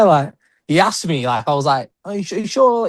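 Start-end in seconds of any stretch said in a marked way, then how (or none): 2.0-2.9 higher-pitched, tentative checking question; retold disbelief. Fan retelling asking his wrestling idol for a signature, mildly disbelieving. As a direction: imitate yourself double-checking an offer.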